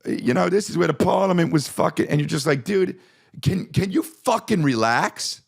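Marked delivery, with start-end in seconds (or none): 0.1-2.1 put-on high-pitched old London cabbie; British accent. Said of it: "it's some old guy". 2.6-5.4 high, drawn-out exasperated plea; exasperated retort. Acted. Fed-up retort to cabbie.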